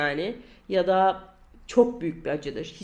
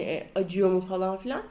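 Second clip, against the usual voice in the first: normal talking voice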